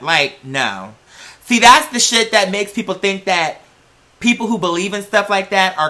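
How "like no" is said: usual speaking voice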